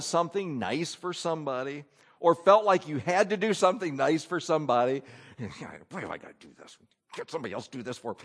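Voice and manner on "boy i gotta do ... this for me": low grumble under the breath; murmuring. Acted. Someone stuck doing a favor, murmuring to himself. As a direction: mutter reluctantly to yourself. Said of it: "That's murmurings".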